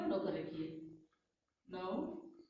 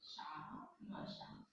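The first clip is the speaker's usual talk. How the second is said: शाम very slow and much softer, nearly a whisper; soft drawn-out शाम; 4 semitones lower, 12 dB quieter, much slower than usual. Shown as a demonstration. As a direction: breathe शाम softly to stress the श sound. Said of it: "ये वाला श लगाना".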